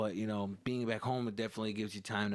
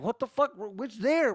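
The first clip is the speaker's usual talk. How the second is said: much higher, louder confused outburst; baffled son; 11 semitones higher, 7 dB louder than usual. Retold or imitated. Son yelling back at his dad, high and baffled. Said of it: "I'm like".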